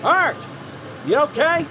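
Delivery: high, alarmed shout down a hole; calling out. Acted. Worker calls to his fallen partner, alarmed shout. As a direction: yell his name down the pit.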